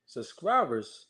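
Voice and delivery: usual speaking voice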